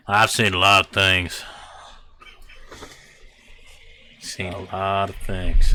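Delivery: mild hillbilly drawl, slow. Acted. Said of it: "Good thing you didn't do a hick accent".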